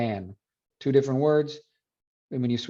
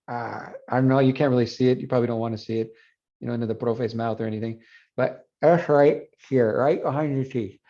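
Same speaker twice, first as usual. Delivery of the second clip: ordinary talk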